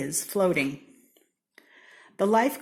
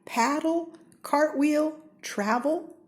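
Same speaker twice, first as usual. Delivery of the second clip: higher and louder, bouncing with energy; excited; 10 semitones higher, 3 dB louder than usual. Acted. Reading to kids, springing up on action words. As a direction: burst up with each action word. Lively list of action verbs.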